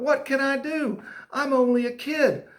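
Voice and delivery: high, emphatic storybook plea; helpless kid. Acted. Kid narrator wondering how to save school, helpless plea. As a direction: protest like an overwhelmed child.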